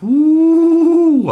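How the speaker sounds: a loud, high cheering woo; excited whoop. Real, not acted. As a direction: let out a big happy whoop. Cheering out in chat stream.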